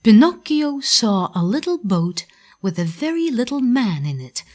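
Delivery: ordinary delivery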